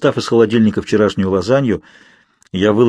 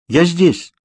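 calling back; 6 semitones higher than usual. A narrator's reading. Answering father's call.

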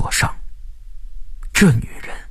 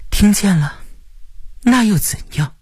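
cool, higher-pitched defiant retort; cold; 11 semitones higher than usual. A narrator's reading. Qi Jia answering the man with the gun, coldly. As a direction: answer coldly, unimpressed by the threat. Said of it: "他冷冷地说".

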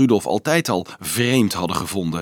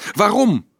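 high, loud, defiant outcry; wild cry; 12 semitones higher, 5 dB louder than usual. A narrator's reading. Marieke challenges the priest holding her back, wild cry. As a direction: fling the question out wildly. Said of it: "riep ze wild".

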